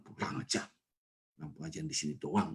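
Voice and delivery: breathy, sulky under-the-breath reply; muttered retort. Retold or imitated. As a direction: mutter it as you walk out. Offended man muttering while leaving.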